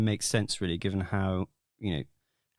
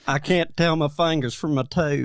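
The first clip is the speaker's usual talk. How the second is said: Southern mountain accent, a bit louder; Appalachian accent; 5 semitones higher, 5 dB louder than usual. Shown as a demonstration. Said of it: "an Appalachian mountain accent".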